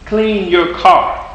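very slow insistent repeat. Retold or imitated. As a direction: draw out "Clean your car." further. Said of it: "You're like".

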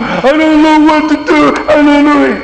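high, loud panicked wail; loud frantic cry of not knowing what to do; freaking out. Retold or imitated. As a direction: act out someone who has lost everything and panics. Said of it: "they just freaked out".

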